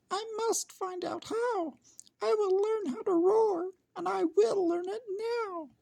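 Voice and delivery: a tiny mouse making up his mind; determined mouse. Acted. Mouse voice in story.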